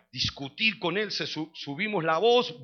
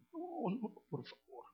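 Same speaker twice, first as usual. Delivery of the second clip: llanto imitado; 2 semitones lower, 12 dB quieter, much slower than usual. Retold or imitated. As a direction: imitate a weeping father begging forgiveness. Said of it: "se pone a llorar y dice". (imitación de padre lloroso que suplica bajito)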